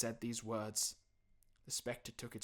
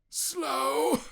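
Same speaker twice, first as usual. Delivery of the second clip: high, loud wailing spectre; loud anguished ghost cry; 14 dB louder than usual. Acted. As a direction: moan it like a tormented ghost. Ghost voice in story.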